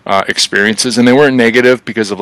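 ordinary talk, nothing marked

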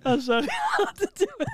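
words said through laughter; laughing. Real, not acted. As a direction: giggle out the apology. Laughing at his own joke.

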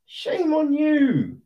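high, sing-song "Shame on you" with a falling "you"; high mocking scold. Repeated rebuke to FIFA.

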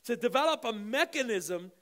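loud, high-pitched, impassioned preaching; fervent preaching prayer. Acted. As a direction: pray over the congregation with full force. Fervent prayer over music.

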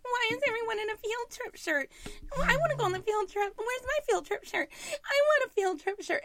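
put-on high whimpering kid voice; whimpering child. Mother imitating her son whining about the shirt, high. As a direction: whine like a boy left out. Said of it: "never-ending, like, whimpering".

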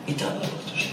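התעלה בקדושה whispered, hushed and soft. Shown as a demonstration. Rabbi to his listeners, repeating התעלה בקדושה in an awed whisper.

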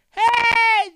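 high loud hey; calling out. Shown as a demonstration. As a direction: hail him from the roadside. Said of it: "You can say".

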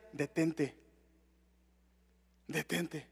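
low, hushed warning from within; soft inner voice. Retold or imitated. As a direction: murmur it like a voice inside your head. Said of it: "una voz interior".

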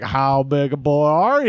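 put-on slow, low Southern accent; unhurried hammy Southern accent. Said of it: "start off in a Southern accent".

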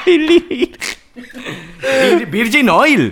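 muy agudo, muerto de risa; entre carcajadas. Real, not acted. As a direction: dilo sin poder parar de reír. Laughing over the pun. (ahogado de risa real por el chiste)

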